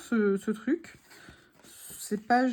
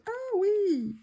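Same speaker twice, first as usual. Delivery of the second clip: much higher cry of delight; excited ah oui; 10 semitones higher than usual. Real, not acted. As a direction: squeal it out with joy. Excited at discovery.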